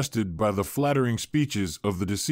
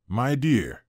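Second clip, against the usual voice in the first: chirpy. A narrator's reading. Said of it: "Thomas chirped".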